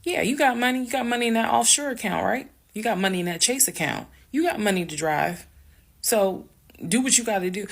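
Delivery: heated, high-pitched retold heckling; retold yelling. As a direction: imitate yourself yelling sarcastically at someone across the room. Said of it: "I'm yelling".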